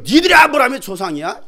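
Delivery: loud, high-pitched scornful retort; indignant challenge. Acted. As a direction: challenge them with outraged disbelief. Jesus provoking angry listeners.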